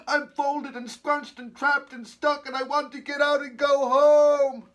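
high, loud, slow wail building to a long last word; yelled complaint. A narrator's reading. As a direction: wail like a trapped, fed-up kid. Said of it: "yelled Haley".

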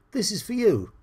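lightly voiced woman handing over a present; woman offering a gift. Woman in the picture offers him a gift, lightly voiced. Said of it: "She's saying".

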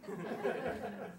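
airy high-pitched chuckle; a quick breathy laugh. Real, not acted. Laugh at friend's mistake.

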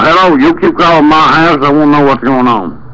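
normal talking voice

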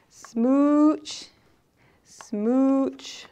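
kiss the air playfully; loud kissy smooches dying away; smooch sounds. Acted. Kiss sounds, building then fading.

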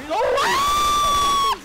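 very high shouted wow; surprised shout. Real, not acted. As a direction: yelp it in surprise. Sudden exclamation.

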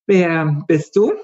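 put-on uneasy girl. A narrator's reading. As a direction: ask it timidly, up high. Mitra asks the creature.

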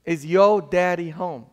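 put-on southern accent, r dropped in "your". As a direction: ask it loudly with a Southern accent. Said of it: "This is my southern accent".

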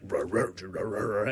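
low, garbled demon noises; demonic voice. Retold or imitated. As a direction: imitate a demon's wordless rumbling. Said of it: "demonic voice".

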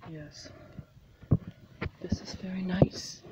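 hushed, admiring whisper; whispering over the painting. Real, not acted. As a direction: breathe it out like a secret. Admiring the artwork.

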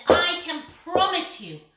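loud. Acted. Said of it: "he said".

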